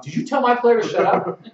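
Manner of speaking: high, loud mock indignation; outraged coach. Coach challenging the ref over a player, loud mock outrage.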